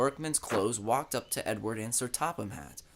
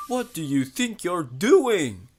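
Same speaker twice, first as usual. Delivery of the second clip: higher, louder, slow cry of alarm; shocked cry; 6 semitones higher, 9 dB louder, slower than usual. A narrator's reading. Edward crying out at the protesters in shock. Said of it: "he cried".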